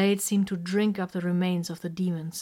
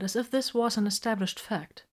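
plain reading voice

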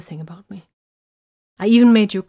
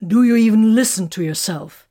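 loud, raised indignant challenge; heated; 4 semitones higher, 8 dB louder than usual. A narrator's reading. Man challenging her over her temper, hot. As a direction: fire it back hotly. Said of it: "he asked hotly".

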